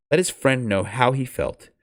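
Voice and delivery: ordinary delivery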